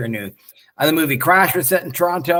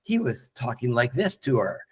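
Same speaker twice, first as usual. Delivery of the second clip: low, soft calm voice; 4 semitones lower, 5 dB quieter than usual. Retold or imitated. Fan imitating the Fly talking to her, calm. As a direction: imitate him talking calmly, lower and softer. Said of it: "his voice was calm".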